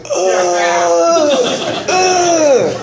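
laughing mock drunk groans, rising; retelling being too drunk to speak, mock groans with a laugh; drunk groaning. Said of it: "I'm like".

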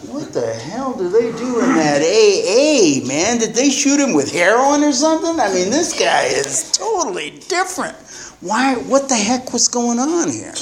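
baffled at his changed father; incredulous. Retold or imitated. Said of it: "And I thought".

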